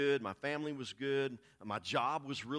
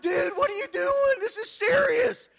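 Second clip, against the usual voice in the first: much higher, loud outraged cry at a laughing friend; indignant shout; 11 dB louder, slower than usual. Retold or imitated. To his laughing pastor friend, a high loud outraged shout. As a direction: yell it like you can't believe he's laughing.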